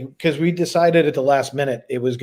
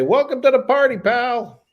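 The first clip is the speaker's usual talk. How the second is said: John McClane; 11 semitones higher than usual. Retold or imitated. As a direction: quote it like a cocky cop hero. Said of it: "as John McClane would say".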